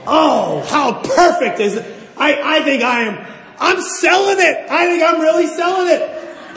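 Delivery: loud, high mock elation; gleeful inner thought. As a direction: crow to yourself as if the act is working. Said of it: "I'm saying to myself".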